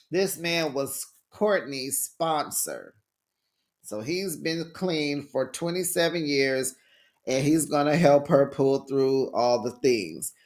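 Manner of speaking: usual speaking voice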